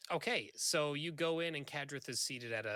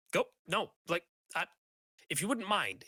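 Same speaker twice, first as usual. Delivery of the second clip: flustered dismissal; 5 semitones higher than usual. Acted. GM voices Kadrith, flustered.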